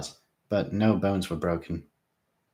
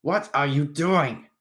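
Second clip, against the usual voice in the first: 1 alarmed demand; 7 semitones higher, 3 dB louder, slower than usual. A narrator's reading. Krebsley confronting drunk prince.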